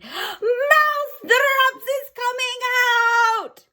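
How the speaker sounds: Grand High Witch announcing her plan, shouting. Acted. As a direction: stretch it out like a triumphant crone. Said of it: "she shouted".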